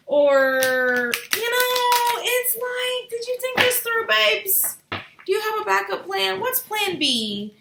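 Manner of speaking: very high, loud sing-song questioning; mock interrogation. Reader grilling impulsive fire signs on their plans, hammed-up high questioning. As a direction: pester them with exaggerated high, loud questions.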